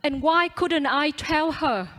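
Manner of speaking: ordinary delivery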